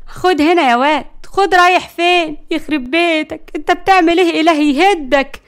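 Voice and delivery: high, loud calling after someone; scolding shout. Acted. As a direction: chase him with exasperated curses. Chasing the boy, calling after him.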